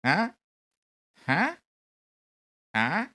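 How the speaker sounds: ordinary delivery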